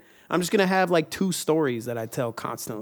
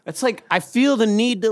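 higher, louder pouting voice; mock impression; 7 semitones higher, 5 dB louder than usual. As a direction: push the chin out and pout the words. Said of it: "worst fucking impressions".

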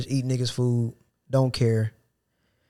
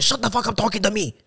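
rude coworker; 9 semitones higher than usual. Retold or imitated. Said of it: "He said".

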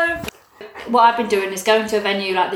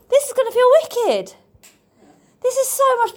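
excited; 8 semitones higher than usual. Real, not acted. Delighted on seeing the stage.

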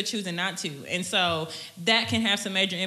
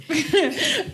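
short high-pitched laugh; quick delighted giggle; 11 semitones higher, 4 dB louder than usual. Real, not acted. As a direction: laugh brightly at the guest. Laughs after guest's answer.

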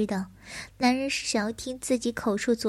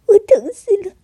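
much higher and louder, near screaming; anguished crying outburst; 6 semitones higher, 10 dB louder than usual. Acted. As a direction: cry it out in anguish.

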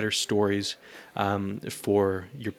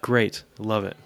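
ordinary delivery

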